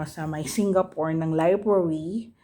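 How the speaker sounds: ordinary delivery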